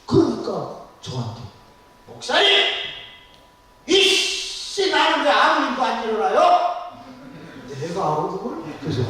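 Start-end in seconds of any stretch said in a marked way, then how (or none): 2.1-6.9 whiny complaint. Retold or imitated. Frustrated churchgoer complains to pastor, high loud whine. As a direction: wail the grievance at the pastor.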